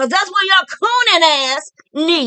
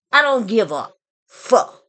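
normal talking voice

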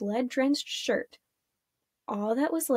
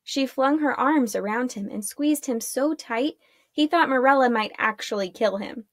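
usual speaking voice

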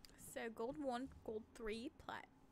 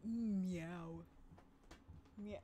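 drawn-out meow in a mock Aussie accent; playful Australian meow; Aussie cat meow; 4 semitones lower than usual. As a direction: stretch out an Australian cat's meow. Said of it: "Au-, Aussie accent".

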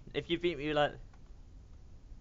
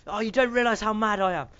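louder, higher mad rant; mock angry rant; 5 semitones higher, 6 dB louder than usual. Real, not acted. Gamer ranting at the screen after losing, mock angry. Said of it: "I'm mad".

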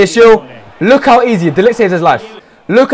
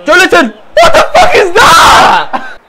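very high, stretched-out screech; full-voice real outburst; outraged scream; 10 semitones higher, 4 dB louder, much slower than usual. Real, not acted. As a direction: scream in disbelief at a blunder. Outraged at player's blunder.

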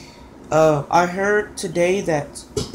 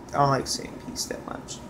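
whispering; 2 semitones lower, 7 dB quieter than usual. Real, not acted. Trailing whisper at video end.

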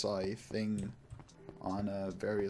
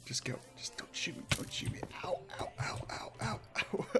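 breathless hushed pleading; frantic whisper; 8 semitones higher, 5 dB quieter than usual. Real, not acted. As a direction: hiss it under your breath while fleeing. Fleeing being shot in game.

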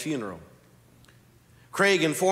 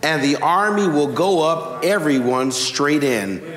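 loud, ringing scripture proclamation; preacher building up to the wall falling; preaching at full force; 6 dB louder than usual. Acted. Preacher proclaims Jericho's fall to congregation, loud preaching.

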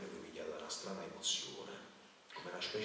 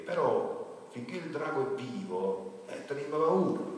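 slow and much louder, rising high; king's loud fearful plea; 7 semitones higher, 11 dB louder, slower than usual. A narrator's reading. King tells the knight the town still fears the dragon, loud. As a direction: insist loudly on the fear. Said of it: "ricette il re".

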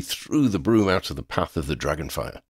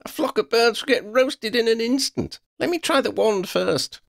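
high, urgent squawking protest; high-pitched exasperated protest. A narrator's reading. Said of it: "Lydia squawked".

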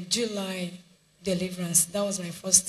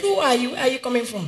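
loud, higher demand in a retold dream; retold shout; 5 semitones higher, 6 dB louder, faster than usual. Woman retelling her dream, yelling at the intruder. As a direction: confront the intruder with a mock yell. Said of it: "I shouted".

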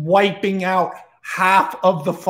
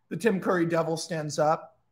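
ordinary delivery, nothing marked

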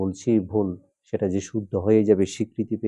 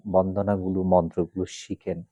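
ordinary talk

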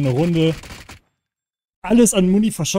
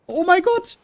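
much higher, loud exasperated cry; panicking gamer; 12 semitones higher, 3 dB louder than usual. Real, not acted. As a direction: squeal it in panic. Runs out of ammo mid-fight. (panischer Aufschrei)

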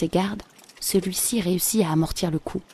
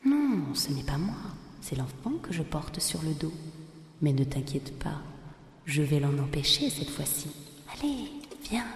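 une voix de femme bien plus douce, qui cajole; rassure-le tout doucement pour le piéger; femme enjôleuse; 2 semitones lower, 8 dB quieter than usual. A narrator's reading. (voix douce et rusée de la femme)

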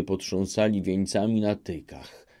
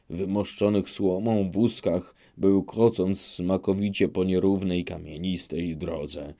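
plain reading voice

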